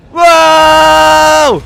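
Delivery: high, loud, drawn-out whoa. Real, not acted. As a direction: let out a long astonished whoa. Sudden reaction to game event.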